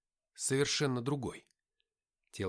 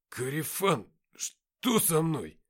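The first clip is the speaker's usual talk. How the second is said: mumbling; 4 semitones higher, 5 dB louder, much slower than usual. A narrator's reading. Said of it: "пробормотал Никита".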